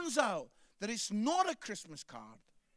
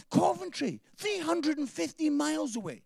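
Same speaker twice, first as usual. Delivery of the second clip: amazed disbelief; 9 semitones higher, 5 dB louder than usual. Real, not acted. Amazed at the distance.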